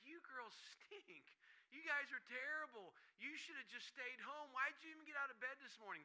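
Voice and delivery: mock the girls like a gloating rival coach; taunting coach. Rival coach jeering at losing girls, high and mocking.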